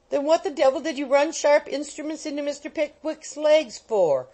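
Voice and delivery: high, loud angry demand; a mild furious old man. A narrator's reading. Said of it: "inquired Wardle angrily".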